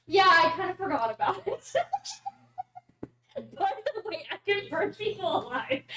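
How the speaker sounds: laughing through the words; cackling at her own reveal. Real, not acted. Gleeful fire reveal.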